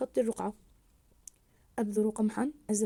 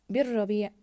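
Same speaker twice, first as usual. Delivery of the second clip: "بالربيع" louder, silent ل and doubled ر; stressed doubled letter; 5 dB louder than usual. Shown as a demonstration. Teacher showing the unspoken lam, stressed doubled letter. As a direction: go from ب straight into a pressed ر in "بالربيع". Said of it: "لماذا لم نلفظ اللام".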